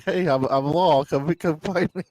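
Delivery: loud, high, slow mock call-out; mock yell. Joking to a friend about horse lanterns, mock yell. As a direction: pretend to holler for attention. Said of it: "that just yells like".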